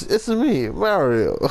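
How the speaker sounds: high-pitched Italian plumber catchphrase; Mario. Acted. Player quoting the video game hero, Mario impression. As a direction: leap up high on the catchphrase, then drop. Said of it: "That's Mario".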